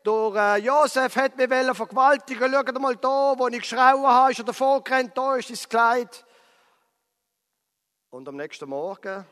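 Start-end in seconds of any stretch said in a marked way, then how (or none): 0.0-6.2 loud, high cry of mock outrage; screaming for help. Potiphar's wife calls the guards to accuse Josef, screaming. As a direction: shout for the guards, sustained and high-pitched. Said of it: "Und sie schreit".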